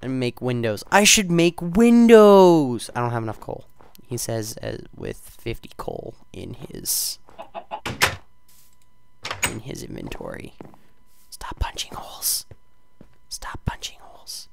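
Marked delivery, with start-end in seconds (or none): 0.9-2.9 slow loud high exclamation; loud drawn-out eureka; excited realization. Real, not acted. Sudden loud idea. 11.3-14.5 unvoiced whispered warning; soft whispered note to self. Real, not acted.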